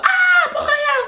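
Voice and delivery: high, loud, drawn-out mock realisation in French accent; mock recognition. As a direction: imitate people suddenly catching on. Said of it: "people go".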